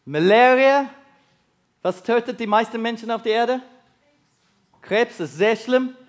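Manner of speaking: usual speaking voice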